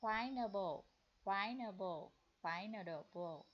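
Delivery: "pineapple" repeated, last take slow, syllable by syllable; model pronunciation. Shown as a demonstration. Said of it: "ở đây mập là". (đọc mẫu "pineapple", lần cuối thật chậm)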